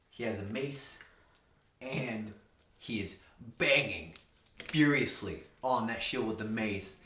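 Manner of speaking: ordinary delivery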